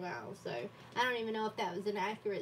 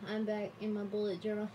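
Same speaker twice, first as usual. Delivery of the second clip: usual speaking voice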